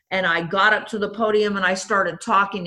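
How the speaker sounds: ordinary delivery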